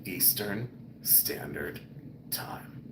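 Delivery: barely voiced, very slow host sign-off; hushed late-night radio close, word by word; velvety chest voice. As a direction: let the low murmur fade to a whisper. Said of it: "Let me talk from my chest".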